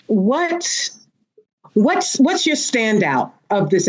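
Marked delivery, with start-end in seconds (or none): none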